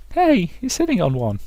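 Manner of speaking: high put-on character voice; artist character. Artist to farmer sitting on her paper, high voice. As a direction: call it out in falsetto.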